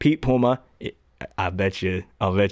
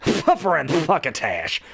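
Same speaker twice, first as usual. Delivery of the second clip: slow, spluttering cat lisp; spray every S like a cartoon cat; wet, slushy lisping catchphrase; Sylvester; 4 semitones higher, slower than usual. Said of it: "Sylvester's easy 'cause it's, it's Daffy, just lower".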